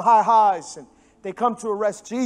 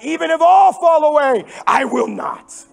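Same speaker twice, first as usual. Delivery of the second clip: much higher and louder, puffed-up brag; cocky disciple's loud vow; boastful Peter; 8 semitones higher, 8 dB louder, slower than usual. Retold or imitated. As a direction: play Peter bragging he'll never fall. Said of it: "Peter's like".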